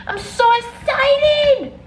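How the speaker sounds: very high, loud, long-drawn squeal; imitated squeal, stretched right out; over-the-top excited squeal. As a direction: top the last take with a hammed-up shriek of excitement. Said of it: "The way the person goes".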